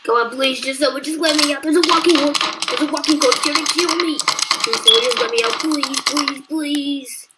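high, loud, panicked begging; frantic pleading. Acted. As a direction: beg someone to open up. Trapped, chased by a monster.

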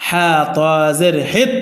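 ordinary delivery

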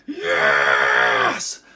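long roaring yes; loud triumphant roar; super excited. Retold or imitated. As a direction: bellow a drawn-out fan cheer. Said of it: "Super excited about that part".

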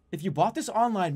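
ordinary delivery

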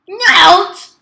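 very loud, high, stretched note; shouted jump command. Real, not acted. Player making the game character jump, shouting high. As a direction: belt one long high syllable.

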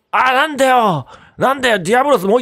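annoyed. Real, not acted. Annoyed at second monster.

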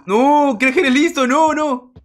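high, loud frantic cries; panicked yelling. Real, not acted. Player being attacked in the game, panicked yelling. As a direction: yell in real panic.

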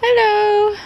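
high, drawn-out greeting to animals; singsong hello. Real, not acted. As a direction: call a high hello to the chickens. Calling to the chickens.

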